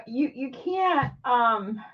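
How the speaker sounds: normal talking voice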